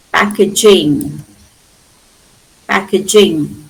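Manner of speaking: PACKAGING as PACK-ij-ing, said twice; maintained suffix sound. Shown as a demonstration. Teacher shows the suffix maintained before I N G, "ij" sound kept. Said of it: "maintained before an E D or an I N".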